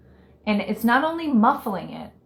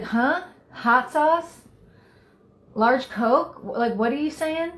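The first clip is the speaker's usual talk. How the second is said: confused customer; 5 semitones higher, slower than usual. Retold or imitated. Confused customer at drive-through speaker.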